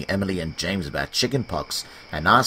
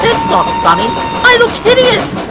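much higher, louder whiny complaint; complaining; 10 dB louder than usual. Acted. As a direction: wail about looking hideous. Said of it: "he complained".

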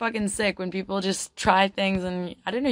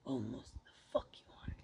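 very quiet, whispered mutter; hushed aside; 7 semitones lower, 19 dB quieter, slower than usual. Real, not acted. Streamer whispering an aside after a viewer's question, hushed. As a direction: drop right down to a whisper.

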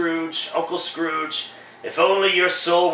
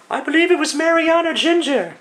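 much higher, airy woman's voice for the ghost; falsetto female ghost; 10 semitones higher than usual. Acted. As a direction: play the spirit as a tall lady. Ghost speaks.

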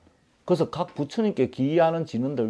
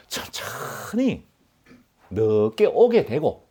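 unhurried, lingering recitation; slow drawn-out; slower than usual. Shown as a demonstration. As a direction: stretch 천천히 out long. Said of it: "천천히는 천천히 읽어야 됩니다".

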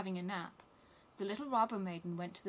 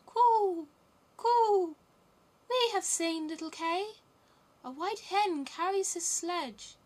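very high, loud bird voice; high, hooting singsong storybook pigeon; cooing wood pigeons; 10 semitones higher, 8 dB louder than usual. A narrator's reading. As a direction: coo and lilt like a pigeon telling news. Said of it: "Then the wood pigeons said".

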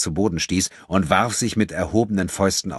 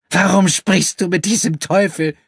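hateful woman's voice; 14 semitones higher, 8 dB louder than usual. Acted. Furious woman accuses André.

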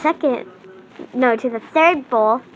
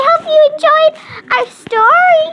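show sign-off; 6 semitones higher, 4 dB louder than usual. Acted.

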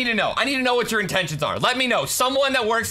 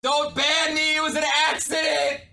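panicking gamer; 3 semitones higher than usual. Real, not acted. Begging not to be banned.

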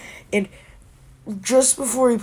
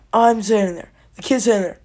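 ordinary talk

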